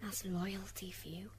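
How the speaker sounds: soft, hushed grumble; whispered aside. Acted. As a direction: whisper it low to counsel.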